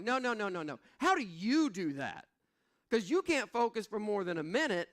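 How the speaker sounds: a hint of kids mocking dad; kids teasing. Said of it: "and they're like".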